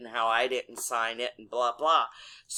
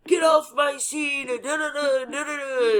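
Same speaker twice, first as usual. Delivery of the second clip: high, shouted mock of a bullying officer; hammed-up tough-cop hollering, trailing into da-da-da; 11 semitones higher, 3 dB louder than usual. Officer bullying the driver at a stop, mocking tough shout. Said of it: "and all tough".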